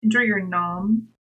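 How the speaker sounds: ordinary talk, nothing marked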